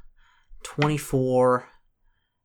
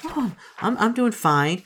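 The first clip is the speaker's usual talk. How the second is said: forced cheerful reply; 12 semitones higher, 4 dB louder, slower than usual. Acted. Forced cheer answering Kelly.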